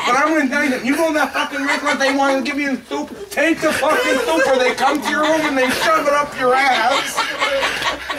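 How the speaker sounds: furious warning. Acted. Departing guest warning the next man about soup, furious. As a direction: yell the warning, stretching the last words.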